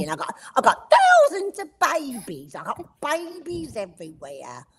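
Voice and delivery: chirpy cockney rabbit mum with a laugh; cockney Missus Rabbit. Acted. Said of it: "so I made her a cockney".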